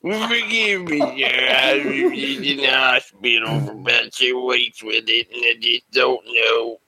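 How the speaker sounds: mush-mouthed, garbled mock apology; slurred stroke speech. Customer explaining himself to drive-thru worker, slurred. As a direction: slur through a hammed-up apology. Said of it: "over your stroke".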